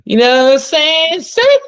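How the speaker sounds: loud, high hype call; shouted call to the crowd. Acted. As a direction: yell it like a hype man.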